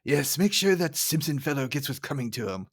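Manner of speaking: lightly voiced cartoon boss; Burns. Burns giving Smithers orders about Simpson, lightly voiced boss. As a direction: give the order in a put-on boss voice. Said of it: "love how Burns is like".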